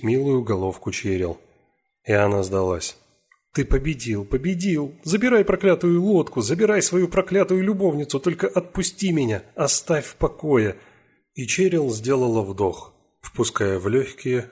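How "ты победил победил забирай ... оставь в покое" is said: desperate pleading cry. Acted. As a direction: beg to be let go, breaking. Cheryl desperately gives up.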